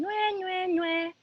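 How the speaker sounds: put-on girl's taunt as the monster hears it; teasing. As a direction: tease in sing-song. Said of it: "He only hears her teasing".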